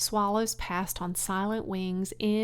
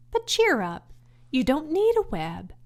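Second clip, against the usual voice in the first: bright lift, higher and louder; cheerful; 6 semitones higher, 6 dB louder than usual. Acted. Charlotte cheering up the sad pig, brightly.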